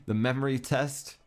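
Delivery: usual speaking voice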